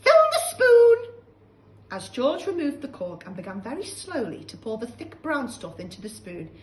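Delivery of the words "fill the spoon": put-on shrill, loud scolding old woman; cranky old grandma. Grandma scolding George, loud and high. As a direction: snap at the boy like a nasty old hag.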